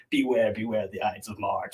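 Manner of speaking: low, ominous poster warning. Retold or imitated. Reading out the teaser posters put up around town, ominous. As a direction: intone it low like a mysterious warning. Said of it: "seem very ominous".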